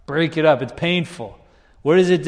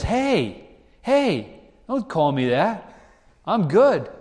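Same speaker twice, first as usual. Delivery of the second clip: higher, hammed-up indignant hey; good person protesting to God, mock offended and higher; 5 semitones higher, slower than usual. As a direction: play a self-righteous man bristling.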